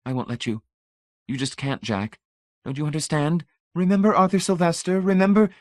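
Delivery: wife blocking husband, growing panic; urgent plea. A narrator's reading. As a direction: build from hushed to frantic. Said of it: "Marcia said swiftly".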